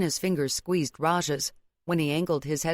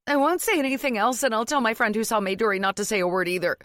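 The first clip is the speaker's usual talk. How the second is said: high, rapid panicked promise; blurted out; 6 semitones higher, 3 dB louder, faster than usual. A narrator's reading. Daughter-in-law promising silence in panic, high and fast. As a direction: blurt it in one fast string. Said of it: "she blurted out a string of words".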